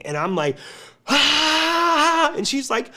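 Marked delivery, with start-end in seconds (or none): 1.1-2.3 sharp high cry of pain from a needle jab; a short yelp of pain as the needle goes in; retold scream of pain. Patient on the clinic table yelps at the injection, high pained cry. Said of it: "I'm, like, screaming".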